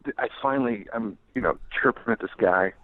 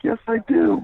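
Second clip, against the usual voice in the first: shouted back while running; 5 dB louder, slower than usual. Retold or imitated. Fleeing reply shouted back.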